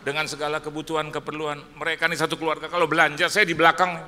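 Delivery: rising preacher's shout toward the wife; preaching shout. Real, not acted. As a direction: pastor shouting the joke at his wife.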